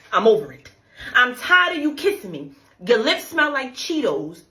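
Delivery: ordinary talk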